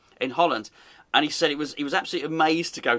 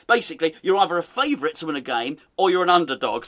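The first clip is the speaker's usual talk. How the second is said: ordinary talk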